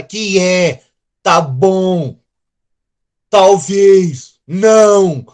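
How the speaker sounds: loud, curt, offhand replies; rude teenager. Retold or imitated. Preacher imitating kids answering parents rudely. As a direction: toss it off like a bored kid. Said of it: "os filhos simplesmente viram falar".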